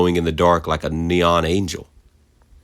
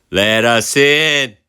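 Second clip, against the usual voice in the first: slow, louder high shouted demand; shouting; 6 semitones higher, 5 dB louder, much slower than usual. A narrator's reading. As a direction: shout it at the door, drawn out. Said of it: "she shouted over and over".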